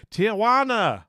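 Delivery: loud, high call of the place name; exclaiming. Real, not acted. Streamer announcing the city to chat, exclaimed loudly.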